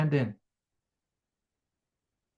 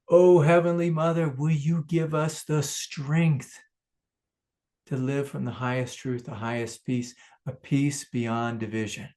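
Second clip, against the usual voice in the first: closing prayer; 2 semitones higher, 3 dB louder than usual. Acted. Speaker prays to Heavenly Mother for peace, earnest.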